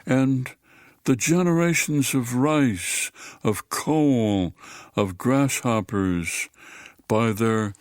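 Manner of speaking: slow, measured poetry reading with long pauses; poem reading. Acted. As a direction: let each line land slowly, like a recited poem. Reads a poem aloud.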